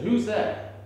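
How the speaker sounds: loud high call into the dark; startled guard yells into the dark. Retold or imitated. Calling out, frightened.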